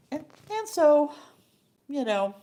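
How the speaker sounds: usual speaking voice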